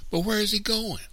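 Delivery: put-on very high child's voice; high-pitched, insistent question; anxious child. A narrator's reading. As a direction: ask like a worried little girl. Child anxiously questions her mother.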